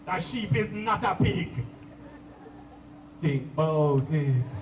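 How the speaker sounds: low, slow Jamaican roots man preaching. Retold or imitated. Roots man preaches to party guests, slow low Jamaican accent. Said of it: "he be like".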